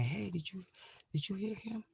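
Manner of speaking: high, soft, urgent asking; hushed high-pitched question from jail. Retold or imitated. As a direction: softly ask a cellmate if she heard that. Retelling her whisper to cellmate.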